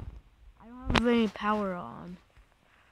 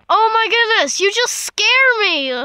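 high, loud startled cry; frightened shriek; 10 semitones higher, 11 dB louder than usual. Real, not acted. Player scared by a mob, high loud frightened cry. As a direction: cry out as something leaps at you.

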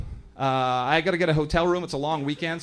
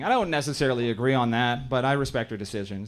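ordinary delivery

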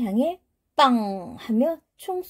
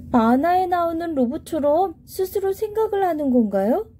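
put-on high, eager little kid; young child voice; 6 semitones higher than usual. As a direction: speak like a curious young child. Child asks curious question.

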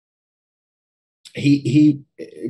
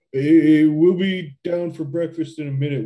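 hasty call; 6 semitones higher, 6 dB louder than usual. Acted. Teen stalling the parents.

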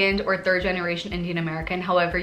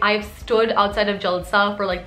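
ordinary delivery

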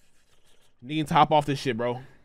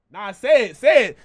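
insistent; 6 semitones higher, 10 dB louder than usual. Real, not acted. Pushing friend to say it.

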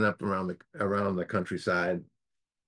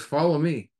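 higher, louder acted command; 6 semitones higher, 5 dB louder than usual. Old lion takes charge of the sheep lion, acted command. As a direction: order him along firmly.

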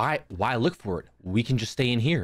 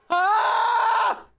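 long, very high shriek; panicked scream; 4 dB louder than usual. Real, not acted. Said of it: "What are you screaming at?".

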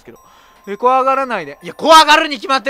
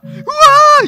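lightly imitated girl's scream; mock scream; 8 dB louder, much slower than usual. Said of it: "言うて".

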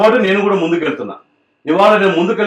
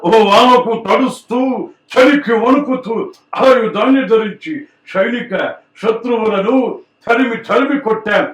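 Senior NTR impression; 4 semitones higher, slower than usual. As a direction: thunder each phrase out like an old film star. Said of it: "senior NTR గారు voice చేయగలరా?".

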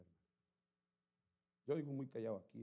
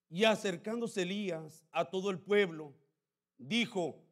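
high, loud, slow preaching voice; fiery preaching; 13 semitones higher, 22 dB louder, slower than usual. Acted. Pastor preaching Elijah to the church, at full force. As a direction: thunder the scripture at them.

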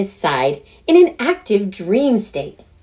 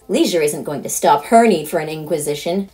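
ordinary delivery, nothing marked

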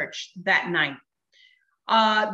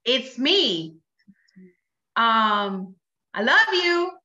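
son on phone; much slower than usual. Retold or imitated. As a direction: imitate a son calling his mom. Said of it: "I hear a voice on the other side".

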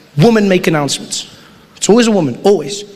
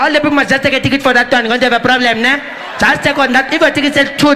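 South African announcer; 3 semitones higher than usual. Retold or imitated. Comic playing a careless Johannesburg airport announcer talking to passengers. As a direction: ramble the announcement like you forgot the mic is on. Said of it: "she'll come and be like".

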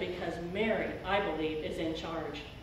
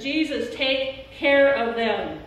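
earnest plea; 4 semitones higher, 7 dB louder, slower than usual. Retold or imitated. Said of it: "And says".

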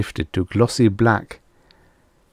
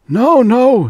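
much higher, louder protest; fearful cry; 8 dB louder than usual. A narrator's reading. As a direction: cry out in fear, high and loud. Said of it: "he cried".

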